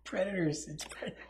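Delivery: hushed whisper. Real, not acted. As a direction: whisper the correction under the breath. Whispered correction, unvoiced.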